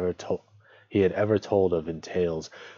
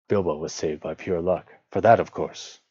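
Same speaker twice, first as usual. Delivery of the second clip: ordinary delivery, nothing marked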